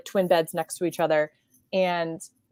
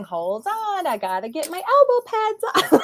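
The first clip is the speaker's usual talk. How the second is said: put-on higher, unbothered kid stalling; mock breezy reply; 11 semitones higher, 3 dB louder than usual. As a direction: brush him off lightly. Her careless reply to hurt brother.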